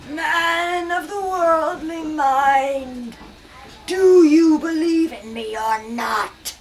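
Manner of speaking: slow, solemn ghostly voice; ghost's voice. Acted. Marley's ghost questioning Scrooge, slow and solemn. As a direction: draw it out like a ghost.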